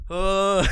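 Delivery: high, helpless cackling laugh; laughing fit with a sighed "uh"; real laughter. Real, not acted. Comic cracking up at his own story, real laughter.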